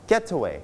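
"getaway" stressed on GET, falling after it; stress on first syllable. Shown as a demonstration. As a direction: land firmly on GET in "getaway". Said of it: "เราเอาว่า getaway". (ลงเสียงหนักที่ GET พยางค์แรกของ "getaway")

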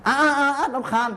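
put-on child groaning at a nagging mom; annoyed child. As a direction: whine like a fed-up kid. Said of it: "บ่นมาก แฉะมาก".

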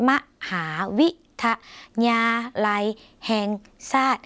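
มหาวิทยาลัยแห่งชาติ with a pause after each syllable. Shown as a demonstration. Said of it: "ทุกพยางค์".

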